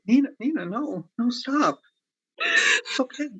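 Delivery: high, tearful pleading to stop her; begging her to stop, voice cracking; choked pleading. Acted. Lover begging the weeping actress to stop, tearful. Konstantin pleading as Nina breaks down.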